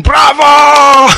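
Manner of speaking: loud high shout of bravo; cheering bravo. Retold or imitated. Theater crowd cheers the dying singer, shouted bravo.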